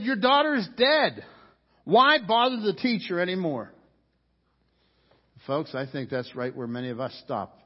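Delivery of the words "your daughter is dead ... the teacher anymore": loud, high, urgent announcement; urgent messengers. Retold or imitated. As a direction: blurt out the bad news. Messengers bring bad news.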